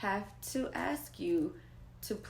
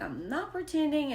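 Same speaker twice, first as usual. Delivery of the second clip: hurt daughter; 7 semitones higher than usual. Retold or imitated. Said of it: "she's like".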